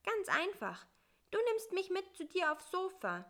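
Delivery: small, high and plaintive; a little fly grumbling high up. Acted. Bissi to the spider at night, high and plaintive.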